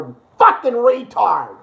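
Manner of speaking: normal talking voice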